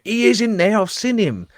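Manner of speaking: high, eager neighbour voice; mock excited neighbour; neighbour tipping him off. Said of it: "He went".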